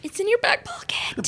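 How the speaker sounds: soft unvoiced whisper. Real, not acted. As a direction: breathe the hint quietly. Whispered hint.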